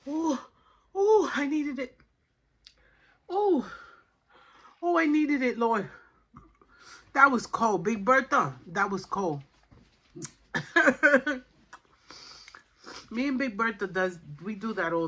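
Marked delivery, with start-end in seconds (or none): 0.1-5.9 high, loud gasping "oh, I needed it"; gasping exclamation. Real, not acted. Gasping at an icy drink. 7.1-8.5 louder exclaimed "That was cold"; shocked exclamation. Real, not acted. Reacting to an icy drink. 10.5-11.4 loud high burst of laughter. Real, not acted. Laughs after the cold drink.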